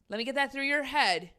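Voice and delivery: usual speaking voice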